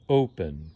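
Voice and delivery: "open" low, with the weight on "o"; stress on first syllable. Shown as a demonstration. Teacher contrasting word stress, "open" stressed on its first syllable. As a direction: hit the "o" of "open", let "pen" fall away. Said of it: "it's the first syllable that's stressed".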